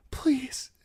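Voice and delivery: high whispered plea; desperate whisper. Acted. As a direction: beg under your breath. Bride clutches at him.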